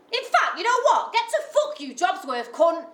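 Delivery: full-throated rage at a petty official; furious shout. Acted. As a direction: explode at her at full force. Exploding at the jobsworth.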